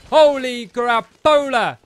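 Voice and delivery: slow, loud drawn-out holy crapola; drawn-out exclamation. Real, not acted. Gamer swarmed by mobs, slow loud shock. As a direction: stretch it out in shock.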